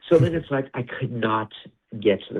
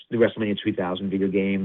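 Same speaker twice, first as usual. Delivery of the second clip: ordinary talk, nothing marked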